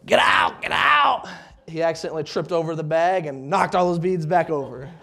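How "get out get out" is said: mock yell, climbing high on the second get out; imitating a wife shouting get out; retold yelling. Wife yelling at him to get out, mock shout. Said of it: "she was yelling at him to".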